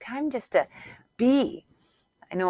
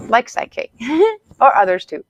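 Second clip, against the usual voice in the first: giggling through the plug, higher and louder; laughing; 6 semitones higher, 8 dB louder than usual. Real, not acted. Coach giggling while plugging her method to listeners. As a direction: laugh at your own sales pitch.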